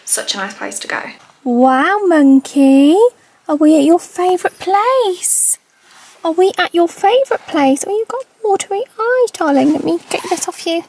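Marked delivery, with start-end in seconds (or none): 1.4-3.0 pet voice. Real, not acted. Dog owner cooing at her puppy in pet voice. 3.5-5.6 high, slow sing-song dog talk; pet voice. Real, not acted. Owner asking her dog in slow pet voice. 6.2-7.8 pet voice. Real, not acted. Owner repeating the question to her dog. 7.8-10.8 pet voice. Real, not acted. Dog owner cooing at her puppy in pet voice.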